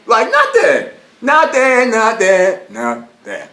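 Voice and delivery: high loud repeated nothing, fading down; emphatic refusal. Retold or imitated. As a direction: shout nothing, then ease off. Retold refusal to detective.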